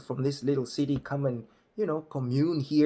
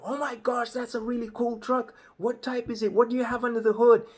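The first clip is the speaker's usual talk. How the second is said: excited locals; 11 semitones higher than usual. Retold or imitated. Locals crowd around new truck.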